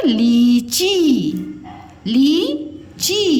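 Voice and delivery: लीची said very slowly, each syllable drawn out; slow stretched word. Shown as a demonstration. Teacher spelling out लीची slowly for pupils. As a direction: draw out लीची slowly, long ee. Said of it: "क्या है आपका शब्द".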